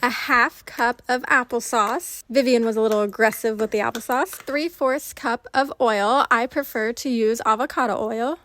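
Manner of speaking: loud upbeat recipe narration; voiceover. Acted. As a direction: read it like a cooking show host. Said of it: "switching over to voiceover".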